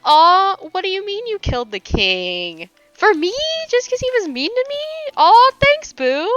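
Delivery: loud, high cooing thanks; mock swooning. Fan thanking someone for killing the king, high coo. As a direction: gush like a gift was given, pitch way up. Imagining a king killed for her.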